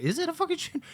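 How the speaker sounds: high-pitched alarmed question; mock alarm. Husband reacting to theater alarm, high mock alarm.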